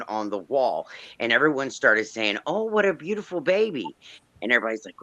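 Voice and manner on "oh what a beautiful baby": put-on high-pitched baby admiration; sweet, lilting mock-quote; retold cooing. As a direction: coo like relatives over a baby photo. Admirers cooing over baby picture.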